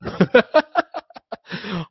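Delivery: long, high-pitched laugh; hearty laugh. Real, not acted. Guest teased as an ignoble mixer, hearty laugh. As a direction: crack up at a teasing insult. Interviewer's mock insult lands.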